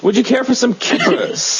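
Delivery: high-pitched mock British accent. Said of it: "he had a British accent".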